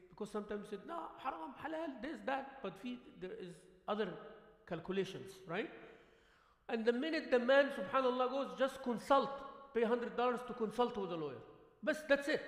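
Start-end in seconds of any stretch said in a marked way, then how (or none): 0.9-2.6 higher, scoffing list of 'haram, halal'; mock pious objection. Mocking people's quick judgments. 8.6-11.4 louder, pressing advice to consult; emphatic advice. Retold or imitated. Retold advice in story.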